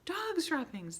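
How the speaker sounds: high, loud chant; witches shouting. A narrator's reading. The whole assembly shouting the alarm. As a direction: shout it all together. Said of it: "they shouted".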